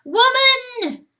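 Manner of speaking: very high, very loud crowing shout; rooster's blaring cry into the dark; bugle crow. A narrator's reading. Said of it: "crows like the bugle".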